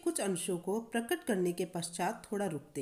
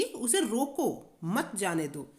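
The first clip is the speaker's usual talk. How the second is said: उसे रोको, a clear break, then मत जाने दो; slow on रोको, stop, run मत into जाने दो; pause after रोको; slower than usual. Shown as a demonstration. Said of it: "यहाँ रोकने का भाव". (रोको के बाद विराम)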